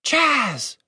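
high, urgent reproach; scolding hiss. A narrator's reading. Woman hushing her shouting companion, urgent scolding. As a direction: snap the name out high to hush him. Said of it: "Catherine hissed".

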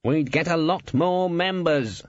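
high, slow mock-reasonable guild boss. A narrator's reading. Guild boss justifying assassins, reasonable voice. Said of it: "in his reasonable voice".

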